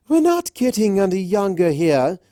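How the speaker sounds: high, loud impatient heckle; calling out. Acted. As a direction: hurry her from the crowd. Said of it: "another from the crowd called".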